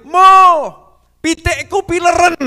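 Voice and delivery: very loud, high-pitched holler in Kudus Javanese; calling shout. Retold or imitated. Habib calls to the father about his sick chickens, high calling shout. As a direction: holler it across the yard. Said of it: "Habib Ja'far udah manggil".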